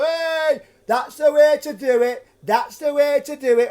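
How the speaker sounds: high, loud, slow Punch voice mockery; mocking drunk. Drunk ruffian taunting the puppeteer with a loud mock Punch voice. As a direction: taunt him in a squawky Punch voice. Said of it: "Over and over again".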